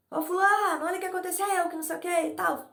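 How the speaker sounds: hammed-up heated complaint about the play; telling a teammate off about the pickup game, mock agitated; nervous, cursing. Shown as a demonstration. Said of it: "Nervoso, xingando".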